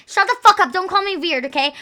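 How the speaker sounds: high, loud burst of protest; defensive outburst. Pretend. Streamer told they're weird by chat, defensive outburst.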